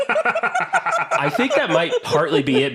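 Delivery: high, laughing admission. Real, not acted. Host admits co-host's jab, laughing. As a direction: giggle it out, caught out.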